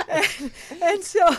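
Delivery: big high laugh spilling into words; real laughter over own story. Real, not acted. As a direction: laugh loud through the start of the sentence. Laughing after her French bluff.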